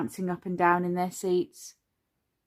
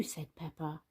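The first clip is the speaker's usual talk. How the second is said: ordinary delivery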